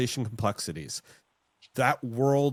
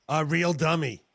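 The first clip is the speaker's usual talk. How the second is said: mother's voice; 5 semitones higher, 4 dB louder than usual. Retold or imitated. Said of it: "as my mother would say".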